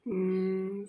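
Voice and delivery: normal talking voice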